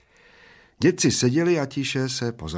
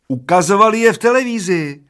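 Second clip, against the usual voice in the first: put-on high, loud yell of a stubborn old man; little old man yelling over his friend; shouting old man; 11 semitones higher, 10 dB louder than usual. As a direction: shout it back, higher and louder. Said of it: "křičel první dědek".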